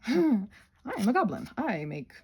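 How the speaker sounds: ordinary talk, nothing marked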